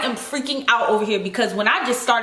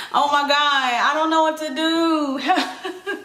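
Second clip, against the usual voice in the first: slow, drawn out through a laugh; laughing helplessly; 2 semitones higher, slower than usual. Real, not acted. Plant owner telling viewers she's lost, slow helpless laugh. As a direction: laugh at her own panic.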